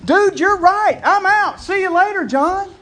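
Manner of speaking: loud, high excited yell; screaming. Retold or imitated. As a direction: shout it like he's leaving right now. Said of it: "He's screaming it".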